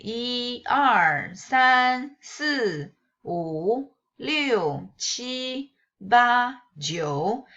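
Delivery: 一 二 三 四 五 六 七 八 九 each kept in its own tone; teacher counting 一 to 九 in Mandarin tones; counting with true tones. Shown as a demonstration. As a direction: count 一 to 九 keeping every tone. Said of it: "when you read them together".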